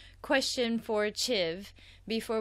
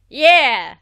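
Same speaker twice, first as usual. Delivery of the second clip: loud victory shout, higher; triumphant yell; 5 semitones higher, 19 dB louder than usual. Retold or imitated. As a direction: shout in triumph after winning. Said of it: "you're like".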